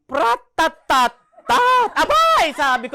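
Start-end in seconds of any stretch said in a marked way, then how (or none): 0.1-1.2 loud slow rattling rat-a-tat; loud mouth-made rifle burst; gunfire sound. Retold or imitated. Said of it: "gunfire imitation". 1.9-2.6 high, loud startled exclamation; loud high astonished outburst; surprised cry. Retold or imitated.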